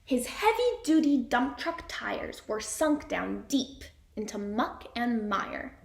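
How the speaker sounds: ordinary talk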